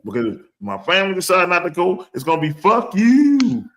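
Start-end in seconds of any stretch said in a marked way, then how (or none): 2.5-3.6 high, loud, gleeful dismissal; defiant kiss-off. Acted. Fan refusing to buy tickets, loudly defiant. Imagined reply to team owner.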